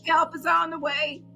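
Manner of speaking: normal talking voice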